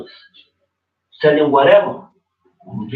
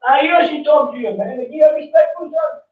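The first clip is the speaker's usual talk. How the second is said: fervent shouting sermon; 9 semitones higher than usual. Real, not acted. Man preaching to a young man about respecting his parents, fervent high shout. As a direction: shout it out like a street preacher, fired up.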